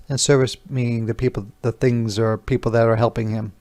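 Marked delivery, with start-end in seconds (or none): none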